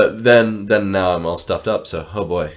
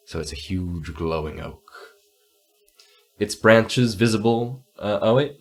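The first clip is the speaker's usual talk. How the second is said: ordinary delivery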